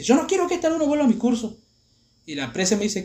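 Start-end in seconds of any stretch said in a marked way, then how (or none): 0.0-1.6 high-pitched imitation of a teacher losing it; furious teacher. Enraged teacher bans student from class, high outrage. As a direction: protest way up high, beside yourself. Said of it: "la profesora sacada le dice".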